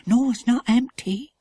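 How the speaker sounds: a lightly voiced timid girl; little girl voice. Tassie figure voice.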